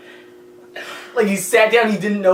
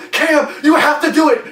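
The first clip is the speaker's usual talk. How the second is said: insistent yell; 10 semitones higher, 5 dB louder than usual. Retold or imitated. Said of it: "and I yell".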